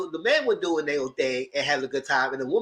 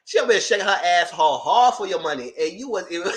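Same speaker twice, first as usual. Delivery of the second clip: ordinary delivery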